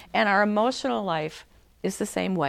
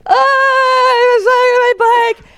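put-on high, loud whiny child voice; crying child; 13 dB louder than usual. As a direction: wail the story like a hurt little kid. Child running to mom crying.

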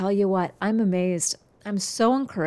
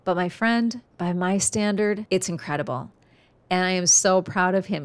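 usual speaking voice

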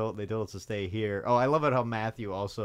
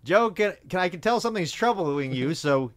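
loud, raised-pitch mock interruption; Dave; 8 semitones higher, 7 dB louder than usual. Retelling how he cuts the confession off, loud mock impatience. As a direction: cut in high and loud, stretching the last words. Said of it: "Dave just cuts him off".

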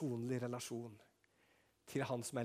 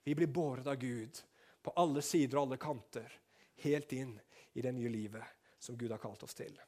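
usual speaking voice

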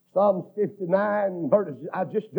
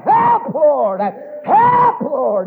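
high, loud, drawn-out holler; crying out for help; 8 semitones higher, 9 dB louder, much slower than usual. Acted. Preacher crying out to God for the congregation, high shout. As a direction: wail it up high, dropping on the second word. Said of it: "it's then we'll cry".

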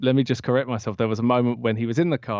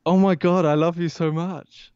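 high, louder, slow adoring gush; starstruck gush; 7 semitones higher, 4 dB louder than usual. Retold or imitated. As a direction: pour it out to your idol. Fan gushing to her idol.

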